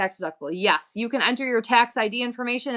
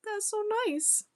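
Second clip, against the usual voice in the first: touched; 10 semitones higher than usual. Real, not acted. Touched by a viewer's compliment.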